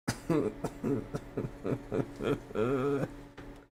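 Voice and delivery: high, helpless run of laughter; long laughing fit. Real, not acted. Laughing at the ugly build, helpless giggling fit. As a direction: laugh on and on until it trails out.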